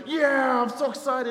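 imitating a happy sports fan; mock cheer. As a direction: cheer like your Boston team just won. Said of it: "you're like".

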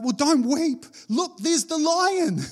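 high, slow, eager pointing; playful, high, slow "look, there's the lion"; excited elder. Acted. Elder's words acted out.

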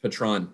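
"Patron" said like the tequila, stressed "-TRON"; deliberate mispronunciation. Shown as a demonstration. Host renaming it after tequila, deliberate mispronunciation. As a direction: say "Patron" as puh-TRON, low and soft. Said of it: "I'm gonna start calling it".

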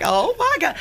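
high, starstruck gasp of words; hammed-up, high-pitched amazement; overwhelmed. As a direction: act out reeling from a celebrity call. Retelling her reaction after the call.

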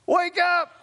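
exasperated call. Retold or imitated. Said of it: "I'm going".

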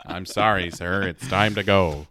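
very low, soft, unmoved official; security guard. Acted. Guard sends the shrieking customer out, deep soft voice. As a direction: drop deep and quiet, politely firm.

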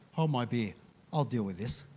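low, offhand Aussie bravado; cocky aside. Acted. Imagined Australian Peter to the disciples, low cocky aside. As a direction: drop it low and casual, self-assured. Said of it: "if Peter was Australian, he would have said".

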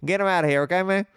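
high, fast hammed-up patriot bluster; mock patriot. Mock patriot expelling redcoats.

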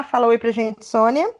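normal talking voice

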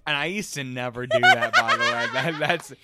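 high laughing squeal. Real, not acted. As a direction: laugh through the words. Laughing while telling.

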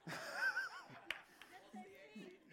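real burst of high laughter. Real, not acted. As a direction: break into open laughter. Audience joke about Job.

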